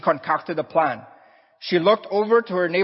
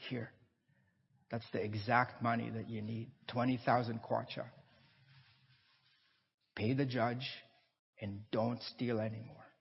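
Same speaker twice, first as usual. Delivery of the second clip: soft, much quieter, kindly words; gentle old man; 5 semitones lower, 15 dB quieter than usual. Acted. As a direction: give it to her tenderly, almost under your breath. Old man gives her the money.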